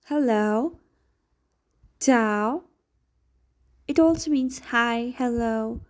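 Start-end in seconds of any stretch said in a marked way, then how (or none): none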